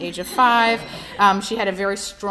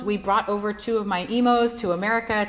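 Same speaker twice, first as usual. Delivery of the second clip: usual speaking voice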